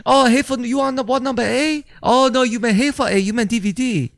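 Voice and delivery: high-pitched mock Chinese accent; hammed-up Chinese accent, voice pushed way up. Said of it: "But in Chinese".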